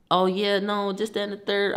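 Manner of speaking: a light impression of the aunt arguing on the phone; mock aunt on the phone. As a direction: imitate her arguing on the phone. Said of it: "She arguing".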